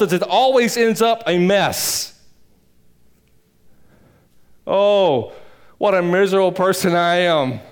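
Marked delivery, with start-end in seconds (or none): none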